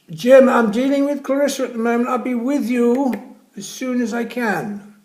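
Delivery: loud, high, slowed call from afar; nurse shouting back. Acted. Nurse shouting to Jim from down the corridor, loud and high. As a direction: project it down the corridor.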